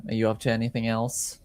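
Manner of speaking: usual speaking voice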